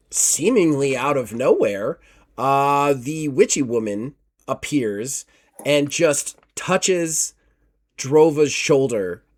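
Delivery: normal talking voice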